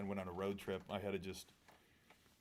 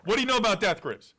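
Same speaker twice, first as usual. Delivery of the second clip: mock angry; 12 semitones higher, 12 dB louder than usual. Mock rant.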